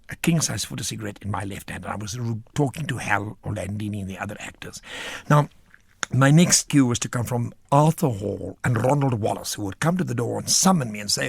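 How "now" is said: ordinary talk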